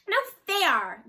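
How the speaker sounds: put-on high, loud childish whine; whiny. A narrator's reading. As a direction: whine like a sulky girl. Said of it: "Jaya whined".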